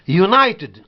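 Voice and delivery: "United" opening yoo, stressed on "ni"; u as you. Shown as a demonstration. Teacher demonstrating U said you, y glide. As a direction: say "United" as you-NIGH-ted. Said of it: "il est prononcé you".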